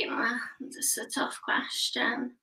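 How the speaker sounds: whispered aside, pondering; whispered musing. Retold or imitated. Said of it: "And I was like".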